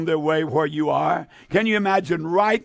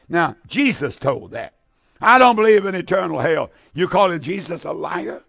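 usual speaking voice

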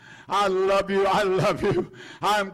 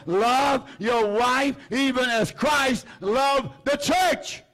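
call to repeat; 5 semitones higher, slower than usual. Real, not acted. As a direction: lead the congregation, climbing high. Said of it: "Say it with me".

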